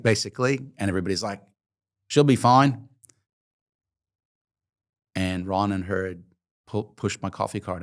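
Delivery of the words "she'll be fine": cheerful group call. Retold or imitated. Everybody reassuring about Kate.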